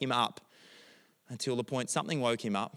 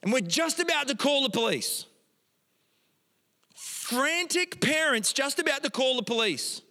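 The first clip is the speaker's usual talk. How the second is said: high, loud, panicked telling; frantic; 5 dB louder than usual. Retold or imitated. Pastor reliving the panic of the search, high and loud. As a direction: race through it in alarm.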